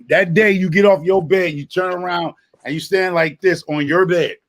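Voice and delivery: ordinary talk, nothing marked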